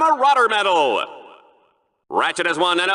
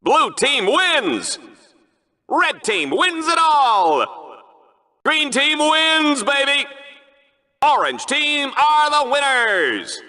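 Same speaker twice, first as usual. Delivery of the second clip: slow, higher, stretched-out announcer call; drawn-out winner call; 5 semitones higher, slower than usual. Acted. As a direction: stretch each win like a stadium announcer. Announcing the winning team.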